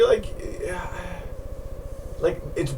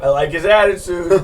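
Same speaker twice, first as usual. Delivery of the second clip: attempted English accent; 3 semitones higher, 7 dB louder than usual. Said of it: "He has an English accent".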